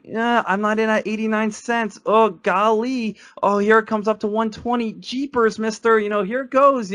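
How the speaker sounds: put-on wide-eyed nervous little boy; wholesome 1950s kid. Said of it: "And if you're just like".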